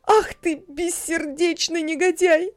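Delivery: rising fury against the heartless dwarf; outraged tirade. A narrator's reading. Hanna denouncing the dwarf to the market women, furious. Mother berates dwarf to neighbours.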